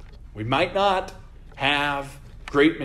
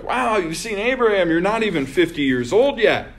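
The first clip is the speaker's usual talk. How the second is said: mocking; 5 semitones higher, 4 dB louder, faster than usual. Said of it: "Kind of mocking him".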